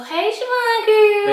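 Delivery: high excited shout-out; excited greeting. Real, not acted. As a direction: call her name out happily, way up high. Greeting cousin on camera.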